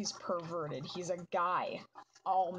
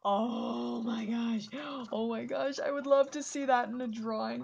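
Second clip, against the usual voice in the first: gushing delight; 5 semitones higher, 4 dB louder than usual. Real, not acted. Gushing over a drawing idea.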